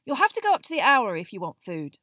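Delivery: loud, irritable dismissal; snapping Harry. A narrator's reading. Boy sending his owl off for food, snapping. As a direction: snap it out loudly. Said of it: "Harry snapped at Hedwig".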